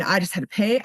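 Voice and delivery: ordinary delivery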